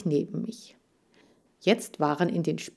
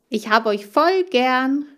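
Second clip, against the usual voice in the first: slow, higher, louder heartfelt call; called out fondly; 6 semitones higher, 5 dB louder than usual. Acted. Hamster calls to his two friends, slow fond shout. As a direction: call it through the opening with affection. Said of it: "rief ich durch die Öffnung".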